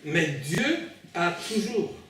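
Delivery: ordinary delivery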